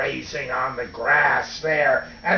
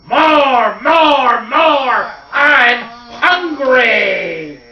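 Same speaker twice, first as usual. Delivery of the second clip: high, loud chant, last word stretched; hungry yell; 7 semitones higher, 7 dB louder, much slower than usual. Acted. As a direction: yell it like a ravenous giant child. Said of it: "and he'd yell out".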